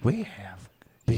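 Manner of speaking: whisper. Acted. Whispered aside.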